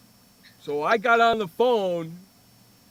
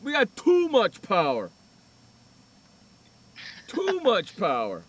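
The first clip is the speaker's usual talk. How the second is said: mock amazement; 4 semitones higher, 6 dB louder, slower than usual. Mocking the repair man.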